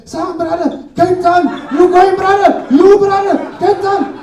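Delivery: high, loud calling from the taxi door; taxi guard yelling. Retold or imitated. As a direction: yell it out like he's hanging off a moving taxi. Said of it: "he's like".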